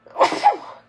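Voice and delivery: loud real sneeze; sudden sharp sneeze burst; sneezing. Real, not acted. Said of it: "Excuse me, I'm gonna sneeze".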